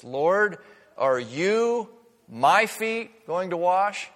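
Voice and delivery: loud, slow, stressing you and my; emphatic word order. Shown as a demonstration. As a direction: lean hard on "you" and "my", slowly. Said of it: "it would sound like this".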